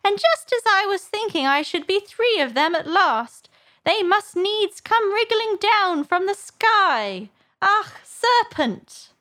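very high, agitated bird voice; rising to shriek. A narrator's reading. Pigeon cursing serpents from the sky, shrieking. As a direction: climb high and wail out the last words. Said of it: "raising its voice to a shriek".

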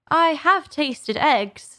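high, slow admission; candid Alice. A narrator's reading. Truthful Alice admitting to pigeon, candid.

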